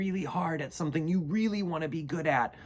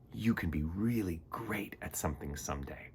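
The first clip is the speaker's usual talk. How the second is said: sincere; 7 semitones lower, 7 dB quieter than usual. Real, not acted. Encouraging kids at the end.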